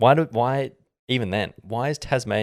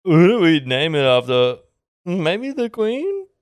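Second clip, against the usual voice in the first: high, slow, unsure settler wondering aloud; louder, much higher mock settler; colonial settlers; 8 semitones higher, 3 dB louder, slower than usual. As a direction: play a clueless colonist. Settlers on boats voiced.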